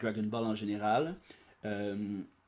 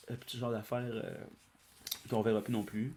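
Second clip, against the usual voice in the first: ordinary delivery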